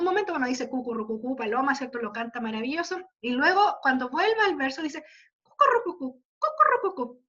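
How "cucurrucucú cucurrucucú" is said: high-pitched cooing like a dove; dove coo imitation. Teacher shows how the singer mimics a dove, cooing imitation. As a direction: coo the word as a dove would. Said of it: "como haciendo el mismo sonido que hace la paloma".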